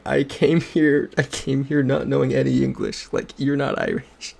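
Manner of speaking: high-pitched imitation of an indignant roommate; offended on friend's behalf. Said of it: "offended for me".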